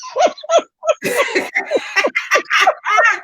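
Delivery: high cackling laugh. Real, not acted. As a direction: crack up.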